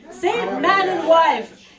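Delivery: slow, loud, high order; impatient prince giving an order; commanding. Acted. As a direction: bark the command at the priest. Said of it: "commanded the prince".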